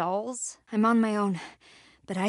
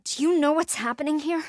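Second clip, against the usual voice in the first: urgent; 6 semitones higher, 6 dB louder, faster than usual. Acted. Urgent question in a crisis.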